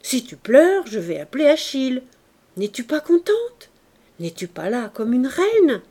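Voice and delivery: loud, high reproach, read in character; scolding. A narrator's reading. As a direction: scold with indignant rising questions. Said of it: "Gasparine la gronda".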